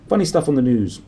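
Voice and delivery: usual speaking voice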